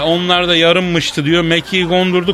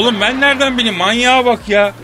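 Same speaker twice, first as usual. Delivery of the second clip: indignant comeback; 6 semitones higher than usual. Acted. Indignant comeback in comic banter.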